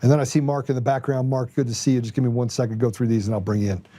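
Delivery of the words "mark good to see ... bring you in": ordinary delivery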